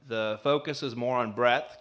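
usual speaking voice

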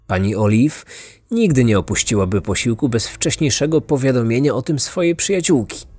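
plain reading voice

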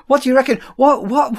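ordinary talk

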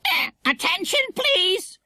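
very high squawky announcement; squawked schoolmaster order, high-pitched; stern parrot. A narrator's reading. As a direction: call for attention like a parrot. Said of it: "said Kiki sternly".

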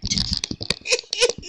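high airy giggle; a breathless, airy laugh; breathy laugh. Real, not acted. Laughing at her wrinkled soap, a breathy giggle.

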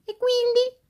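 high, chirpy toy doll voice; squeaky doll voice. Acted. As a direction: play a cheeky little doll.

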